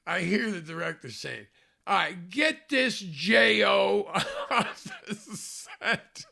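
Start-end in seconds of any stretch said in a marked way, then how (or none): 1.9-6.2 laughing mock yell. Angry director throwing him out.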